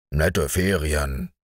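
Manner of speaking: low, slow dry grumble; dog's wry thought. A narrator's reading. The dog Marco thinks about his holidays, low and slow. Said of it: "mochte Marco denken".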